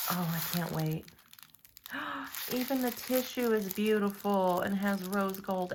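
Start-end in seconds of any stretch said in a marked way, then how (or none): 1.8-2.5 quick high gasp of delight; excited gasp. Real, not acted. Seeing the rose gold tissue, a delighted gasp.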